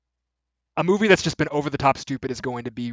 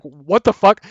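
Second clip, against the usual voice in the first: louder stunned exclamation; mock shock; 5 semitones higher, 9 dB louder, slower than usual. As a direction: blurt it out, floored. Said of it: "you're just like".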